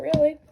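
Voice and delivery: really said high and loud; emphatic really. Real, not acted. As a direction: punch the word really with a jump up. Emphatic afterthought.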